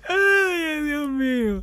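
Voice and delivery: high, shrieking laugh-cry of shock. Real, not acted. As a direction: squeal it out between laughs. (chillido de risa incrédula)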